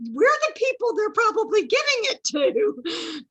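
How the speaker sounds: high, loud imitation of mom; mom's retort. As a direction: squeal it like an amused mom. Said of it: "And she's like".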